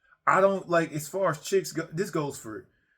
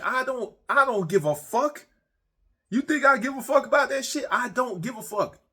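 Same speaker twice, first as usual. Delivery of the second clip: heated yelling; 10 semitones higher than usual. Retold or imitated. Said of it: "And I'm like".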